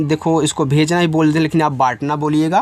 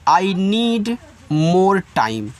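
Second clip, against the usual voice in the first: very slow, each word separated; slow model sentence; much slower than usual. Shown as a demonstration. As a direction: spell out every word slowly for learners. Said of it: "आप इनका उच्चारण कर सकते हैं". (बहुत धीमा, शब्द-शब्द अलग)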